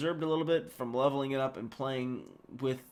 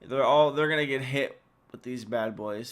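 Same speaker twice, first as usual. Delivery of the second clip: ordinary talk, nothing marked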